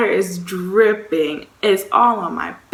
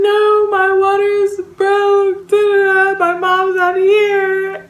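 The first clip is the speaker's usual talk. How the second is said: high, loud, drawn-out wail; hammed-up tearful wailing; mock crying; 12 semitones higher, 7 dB louder, slower than usual. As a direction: act out bawling to your cousin in a panic. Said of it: "And I'm crying".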